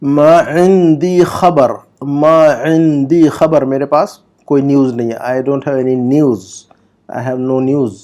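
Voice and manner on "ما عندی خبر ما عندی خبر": slow, syllable by syllable Arabic; slow model reading. Shown as a demonstration. Teacher reads the Arabic example slowly for students. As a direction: read it out slowly for students to copy. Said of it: "number آٹھ کا جملہ پڑھیے".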